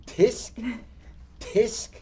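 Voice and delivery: stamp back at him with tsks; monkey tsk. Pretend. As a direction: listener voicing monkeys mocking the peddler. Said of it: "back at him and said".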